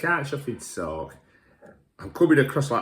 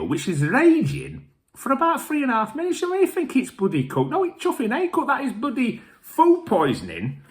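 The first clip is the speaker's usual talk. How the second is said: pitched-up ranting disbelief at the barbecue; incredulous scoffing; 13 semitones higher than usual. Retold or imitated. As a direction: sneer at the raw sausages in disbelief. Scoffing at undercooked barbecue meat.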